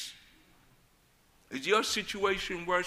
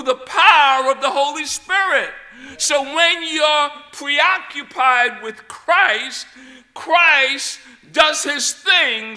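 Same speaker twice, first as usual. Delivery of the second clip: loud, rising sermon climax; full-force preaching; 4 semitones higher, 10 dB louder than usual. Acted. Preacher rallies believers that they can through Christ, loud. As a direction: build the fire of the message.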